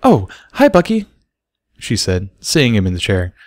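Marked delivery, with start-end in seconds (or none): 0.0-1.1 high, loud surprised hi; spotting him suddenly; bright high filly voice; startled greeting. Acted. Sparkler surprised to see Bucky.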